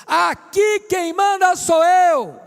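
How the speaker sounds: loud, high, drawn-out authoritarian shout; bellowed household command, stretched out for effect. Acted. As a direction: yell it like a parent imposing authority. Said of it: "é quando você grita".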